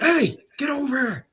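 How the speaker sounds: imitated high call; retold distant yell. Player summoning teammate from the corner, imitated yell. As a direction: holler for someone across the room. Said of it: "yelling from a distance".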